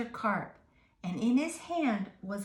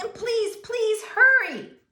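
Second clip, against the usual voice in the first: high, loud, slow begging; pleading yell; 13 semitones higher, 10 dB louder than usual. Acted. Boy pleading loudly with the fireman. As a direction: beg him loudly to hurry. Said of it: "I yelled".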